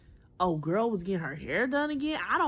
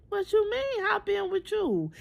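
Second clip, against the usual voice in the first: much higher, delighted disbelief; mock surprised; 12 semitones higher than usual. Young woman answering the man who says hop in, surprised. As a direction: squeal the question in thrilled disbelief. Retold reply to his offer.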